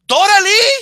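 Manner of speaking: loud, high dramatic call; mock telenovela cry, pitch shooting up. Friend interrupts the heroine from behind, calling out high and loud. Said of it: "you hear some bitch's voice in the background".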